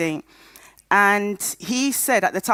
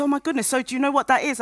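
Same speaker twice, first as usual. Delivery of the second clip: high, quick imitation of a concerned doctor; mock-alarmed question, pitched up and hurried; retold concern; 7 semitones higher, faster than usual. Doctor reacting to her patient's tears, retold concern.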